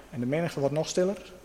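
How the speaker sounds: ordinary delivery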